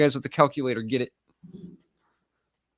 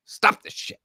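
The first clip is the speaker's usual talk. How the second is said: usual speaking voice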